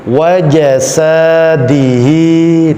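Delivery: WAJASADIHI slow with light "æ" vowels; thin, smiling. Shown as a demonstration. Teacher giving the right reading, smiling Wajasadihi. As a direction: keep a smile all through Wajasadihi. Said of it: "Tetap tersenyum".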